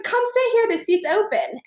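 high, friendly beckon; eager invite. Retold or imitated. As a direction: call her over, voice lifted high. Friend waving her over.

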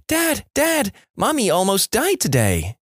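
put-on very high, alarmed little boy; mock panicked child. Johnny runs to dad with news, acted panic. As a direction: play a kid calling out in panic. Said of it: "in a state of panic".

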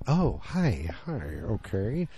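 imitating a flirt deflating, slow and trailing; drawn-out mock-awkward hello, sinking away; awkward letdown. Flirt learns he has a wife.

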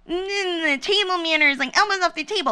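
mock a stuffy manners teacher; lightly hammed-up prim lady; prissy etiquette voice. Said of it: "I am not even one to be like".

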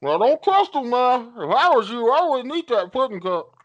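Steve Harvey impression. Warning a stranger about the pudding cup, high and loud. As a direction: warn the friend off, wide-eyed. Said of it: "and he's like".

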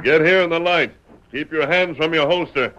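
loud, high firm order; commanding shout. Acted. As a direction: bark it so he obeys at once. Ordering stranger into the light.

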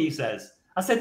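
usual speaking voice

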